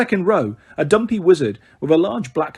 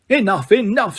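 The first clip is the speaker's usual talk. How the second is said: supercilious; 6 semitones higher than usual. A narrator's reading. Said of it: "with a very supercilious look".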